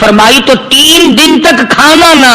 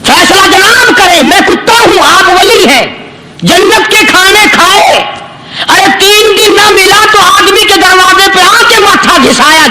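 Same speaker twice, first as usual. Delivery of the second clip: higher-pitched mocking dog voice; the dog talks back, needling and higher; dog's reply; 6 semitones higher than usual. Dog rebuking the holy man, mocking and higher. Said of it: "کتے کو بھی زبان دی".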